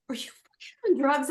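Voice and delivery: high mock incredulous husband. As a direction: ask it high and disbelieving. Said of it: "He's like".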